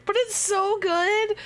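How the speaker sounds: high and laughing, slowed. Real, not acted. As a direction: gush through a laugh. Laughing at the art.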